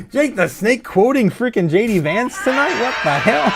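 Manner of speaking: what the hell, half laughing; laughing disbelief. Real, not acted. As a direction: blurt it out, amazed and cracking up. Shocked by chat's quote.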